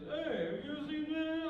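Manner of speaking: high-pitched mock character line; cartoon character voice. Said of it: "all my characters are like this".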